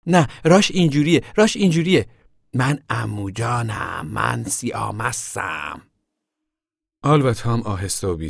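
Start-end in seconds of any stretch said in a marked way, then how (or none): 0.1-2.1 high and loud, bossy and eager; girl correcting her brother's drunk act, high and loud; eager correction. Pretend. 2.5-5.9 put-on slow, slurred childish drunk act; girl showing how a drunk uncle talks, slow and hammed-up; mock drunk uncle.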